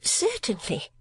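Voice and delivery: high, affected spinster voice; mincing lady voice. A narrator's reading. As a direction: play a fussy miniature painter. Miss La Creevy speaks.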